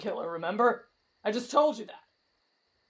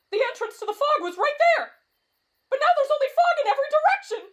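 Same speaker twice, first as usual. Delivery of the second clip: high frantic protest; rising panic; 11 semitones higher, 4 dB louder, faster than usual. Acted. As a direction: build to a panicked cry. Trapped in fog.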